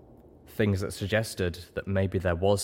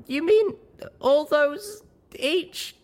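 very high, slow, halting squeal; high-pitched, stammering disbelief; embarrassed squeak; 5 dB louder, much slower than usual. A narrator's reading. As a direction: squeak it out, mortified. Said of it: "I squeaked".

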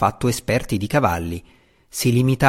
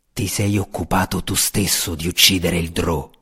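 calm menace; 6 semitones lower than usual. A narrator's reading. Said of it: "disse Roddy con calma".

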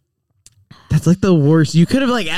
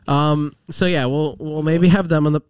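ordinary delivery

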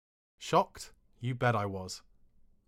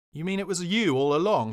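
higher, louder indignant demand; furious friend realising the prank; angry disbelief; 6 semitones higher, 7 dB louder than usual. Retold or imitated. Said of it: "to extreme anger".

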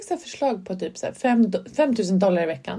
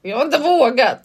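said again louder and higher, clearly; louder repeat; 5 semitones higher, 6 dB louder, slower than usual. Real, not acted. Repeating for a friend who didn't hear, louder and clear. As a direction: repeat it so she finally hears.

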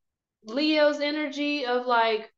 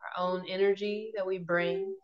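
guided meditation; 5 dB quieter than usual. Acted. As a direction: ease them gently into calm.

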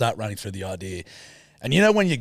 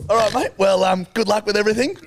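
higher, louder, breezy brush-off of the pitch; imitating the boss; 9 semitones higher, 4 dB louder than usual. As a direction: send him off with a cheery dismissal. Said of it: "and he goes".

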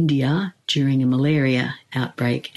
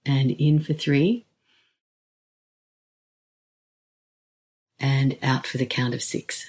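guided meditation. Acted. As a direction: ease them in with long pauses.

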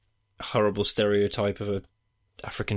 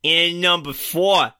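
a bad American accent, hammed up and slow; 5 semitones higher than usual. Ranking read to his co-host in a bad American accent. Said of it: "So I'll just do my American accent then".

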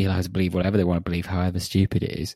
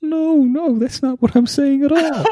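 very high, louder parody voice; mock indignant protest; 6 dB louder than usual. Co-host copying the silly protest, mock indignant protest. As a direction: echo the protest in an absurdly high voice.

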